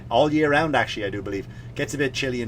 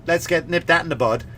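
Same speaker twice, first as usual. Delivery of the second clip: normal talking voice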